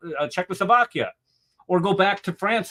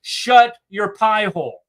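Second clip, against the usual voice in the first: louder, slow "shut your pie hole"; exasperated slow put-down; loud retort; 6 semitones higher, 7 dB louder, slower than usual. Real, not acted. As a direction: bark it back at them. Retort to imagined critics.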